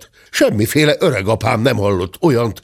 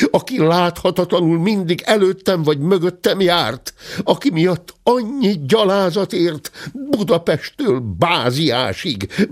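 a hint of an outraged gentleman; 8 semitones higher than usual. A narrator's reading. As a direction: pile up the grievances in disbelief. Gentleman's furious accusation. (felháborodott úr vádaskodása)

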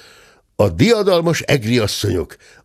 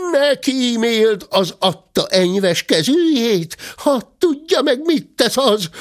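high-pitched scolding market women; high, indignant women's voice; clamouring women; 12 semitones higher than usual. A narrator's reading. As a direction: cry out accusations in a high voice. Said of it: "kiáltották az asszonyok".

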